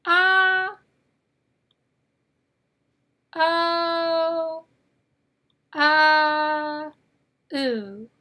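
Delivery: "Ah, oh, ah, ooh" each drawn out clearly, the first loud; O vowel sounds. Shown as a demonstration. Teacher drilling the four O sounds, loud and drawn out. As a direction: chant the four O sounds slowly for the class. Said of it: "third sound for the letter O".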